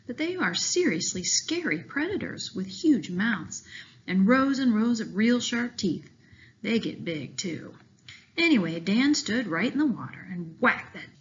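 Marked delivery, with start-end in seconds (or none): none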